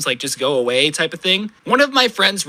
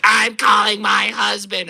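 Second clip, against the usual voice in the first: slow, drawn-out hammed-up yell at the kids; mock angry shout; 3 semitones higher, slower than usual. Angry woman yelling slowly at the skater kids she's chasing. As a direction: bellow it slowly like an outraged lady.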